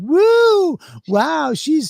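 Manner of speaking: mock crowd cheer. Retelling the crowd taking the waitress's side, hammed-up cheer. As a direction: whoop it up like a crowd siding with her.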